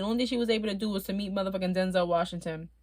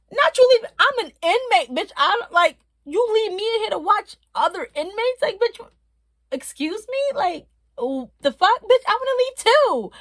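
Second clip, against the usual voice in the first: high, loud outraged rant at the officer; indignant rant; 9 semitones higher, 4 dB louder than usual. Retold or imitated. Inmate ranting that the officer left her to watch others, loud and high. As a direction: tell the guard off, scandalised.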